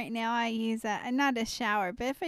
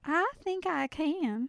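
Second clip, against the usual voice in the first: put-on Southern accent; 5 semitones higher, slower than usual. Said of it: "I can do Southern accent pretty well".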